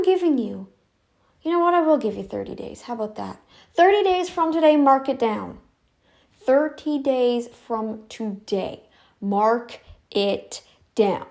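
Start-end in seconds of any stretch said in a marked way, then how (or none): 3.7-5.6 higher, louder, at normal pace; raised, insistent voice; emphatic deadline. Real, not acted. Sets deadline, then repeats slowly. 6.4-11.2 word by word, very slow; half-speed, emphatic enunciation; slow, deliberate. Real, not acted. Repeats deadline word by word.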